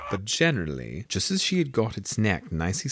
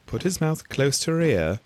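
plain reading voice

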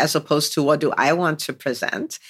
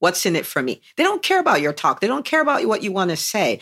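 normal talking voice

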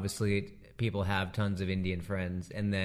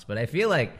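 normal talking voice

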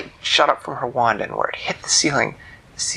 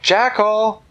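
higher, louder call; 10 semitones higher, 5 dB louder than usual. Acted. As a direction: call into the night. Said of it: "she said".

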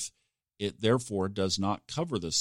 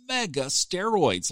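ordinary talk, nothing marked

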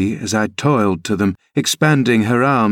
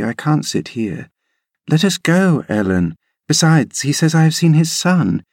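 put-on higher girlish voice, bossy and bright; a girl urging her nurse uphill; eager young Cathy; 3 semitones higher than usual. Cathy argues with Ellen.

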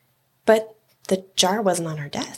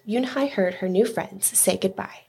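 ordinary delivery

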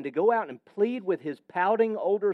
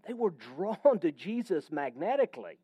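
usual speaking voice

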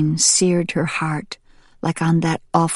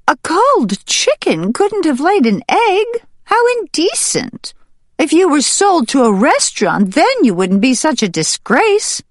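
much higher, shrill-edged mocking jeer; jeering hen; 12 semitones higher than usual. Hen jeers at Sprout, much higher and mocking. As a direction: sneer at her from up high. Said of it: "as the hen jeered".

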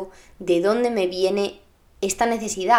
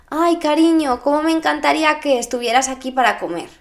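a touch of sweet, higher mum calling; loving mother; 6 semitones higher, 3 dB louder than usual. Retold or imitated. Mother gently invites teen to lunch, mildly sweet. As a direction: coax lightly and fast, all affection. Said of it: "si tu madre te dijese".

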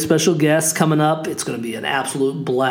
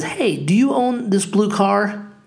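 higher-pitched, drawn-out imitation of a lady; retold older lady; 7 semitones higher, slower than usual. Lady knocks to ask about the car, imitated older lady. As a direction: lightly put on an older lady's slower, higher voice. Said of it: "lady, probably in her".

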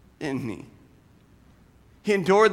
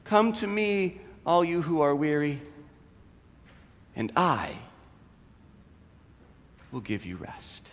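softer and lower, dying away to a hush; fading to hush; 8 semitones lower, 9 dB quieter than usual. Acted. Preacher closing the sermon softly, fading to amen. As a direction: let the closing words fade gently.

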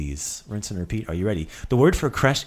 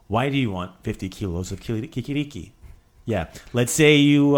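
normal talking voice

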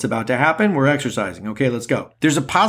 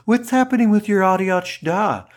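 put-on Californians sketch accent; 5 semitones higher than usual. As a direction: play a freeway-obsessed SoCal character from SNL. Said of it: "if you guys watch Saturday Night Live".

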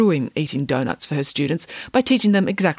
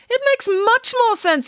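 high, slow, insistent nanny voice; emphatic nanny; slower than usual. Acted. Nanny answering Derek's doubt, emphatic. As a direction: stretch it out with conviction.

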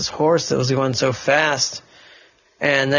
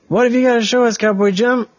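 put-on boy's voice; 8 semitones higher, 3 dB louder, faster than usual. Boy character asks in story.

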